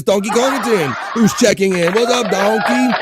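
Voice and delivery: high, loud hype-man announcement; hyped greeting. Acted. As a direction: call out the new arrival with big excitement. Announcing a chat regular's arrival.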